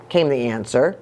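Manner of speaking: usual speaking voice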